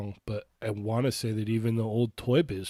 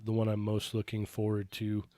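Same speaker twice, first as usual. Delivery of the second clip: normal talking voice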